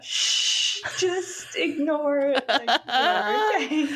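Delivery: high, slow, laughing shush; laughing reassurance. Retold or imitated. Husband Nathan calms his wife, high laughing shush. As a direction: shush her through a laugh. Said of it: "Nathan was like".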